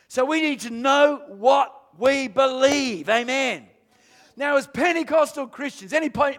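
loud, ringing sermon delivery; preaching at full force. Real, not acted. Pastor proclaiming Christ's return to the church, loud and ringing.

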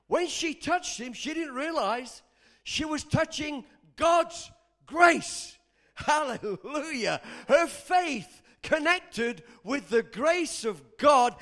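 loud, slow, emphatic preacher; full-force preaching. Real, not acted. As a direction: proclaim it to the whole congregation.

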